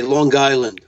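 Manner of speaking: "Long Island" run together as "Lawn Guyland"; thick New York "Long Island" with a hard G; Long Island accent. Shown as a demonstration. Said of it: "a lot of people say".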